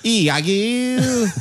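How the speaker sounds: high, loud grand stage voice; hammy Shakespearean actor. Pretend. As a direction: boom Iago like a ham actor.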